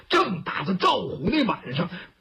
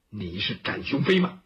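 deep, menacing murmur of a challenger; low-voiced question; 13 semitones lower than usual. Bai Yutang confronts his rival on the roof, low voice. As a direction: drop the voice right down to ask. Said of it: "白玉堂低低的声音就问".

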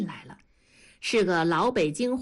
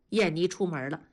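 plain reading voice

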